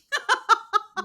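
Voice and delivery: high, loud burst of laughter; bright peal of amused laughter; real laugh. Real, not acted. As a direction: laugh out loud at your own remark. Laughs after joking "Not really.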